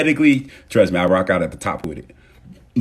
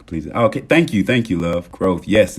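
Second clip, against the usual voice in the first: ordinary delivery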